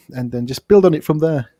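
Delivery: ordinary talk, nothing marked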